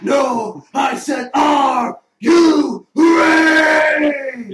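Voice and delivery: booming announcer yell with a long drawn-out READY; rallying shout. Acted. Host rousing the contestants before question one, drawn-out shout. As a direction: build to a huge, slow "are you ready".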